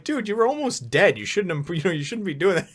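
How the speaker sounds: high-pitched mock exasperation; hammed-up incredulous telling-off; imitated scolding crewmates. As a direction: scold a friend in a loud, high put-on voice. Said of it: "And they're like".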